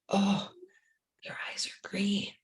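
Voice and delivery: soft whispered discovery, imitating a resident. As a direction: whisper back in hushed wonder. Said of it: "because when we whisper".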